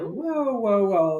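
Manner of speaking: high first whoa, then easing down; taken aback. Retold or imitated. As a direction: back off in retold surprise. Taken aback by being yelled at.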